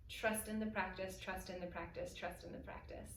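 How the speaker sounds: trust in the practice, fading to a whisper. Acted. Repeated mantra fading to whisper.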